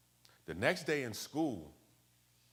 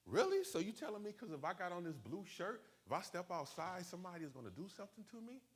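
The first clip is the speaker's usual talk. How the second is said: high-pitched, disbelieving comeback; can't-believe-it questioning; incredulous; 10 semitones higher, 4 dB quieter than usual. Retold or imitated. Retelling his disbelief at cousin's warning.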